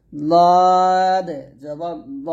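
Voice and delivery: লদ stretched very long and loud; long লদ, then heavy rounded ল; heavy Dad. Shown as a demonstration. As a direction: slowly intone লদ as a thick "dawd". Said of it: "এটা হবে".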